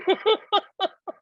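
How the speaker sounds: high, bright burst of real laughter. Real, not acted. Telling how Alan asked her to sleep naked, high laugh.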